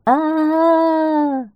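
high, long-held "aaah" cry; hedgehog's sustained distant scream; cracking-voice scream. A narrator's reading. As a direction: wail out one high, stretched cry. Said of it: "ёжикиным срывающимся голосом".

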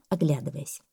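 usual speaking voice

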